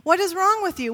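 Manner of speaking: exasperated queen. Acted. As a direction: snap it at a sulking husband. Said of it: "Strong woman that she is".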